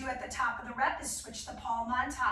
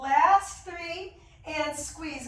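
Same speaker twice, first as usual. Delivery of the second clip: slow, louder strained push; doing sit-ups; 6 dB louder, much slower than usual. Real, not acted. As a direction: call it out while crunching hard. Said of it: "Oh my gosh, my abs on fire".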